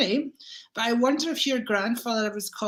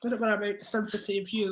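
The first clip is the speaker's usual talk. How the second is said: ordinary talk, nothing marked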